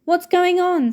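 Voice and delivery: put-on girl just arriving asks the crowd; Ginny asking. A narrator's reading. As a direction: call out anxiously. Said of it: "said Ginny".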